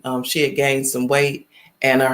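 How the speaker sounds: ordinary delivery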